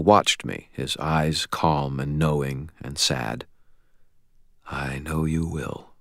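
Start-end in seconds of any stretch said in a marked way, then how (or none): 4.7-5.9 soft, slow, quiet assurance. A narrator's reading. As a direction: say it slowly and quietly. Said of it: "he said quietly".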